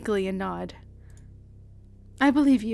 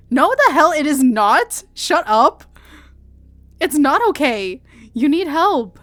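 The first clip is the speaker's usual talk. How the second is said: loud, raised protest; furious denial, voice raised; desperate shouting; 4 semitones higher, 8 dB louder than usual. Acted. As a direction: yell at him to get help. Refusing to accept his dying words.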